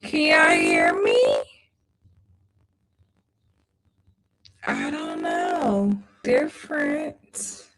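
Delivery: distorted, low, warped stream audio; broken mic, voice dropping out; glitchy audio. Real, not acted. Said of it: "is it not sounding good".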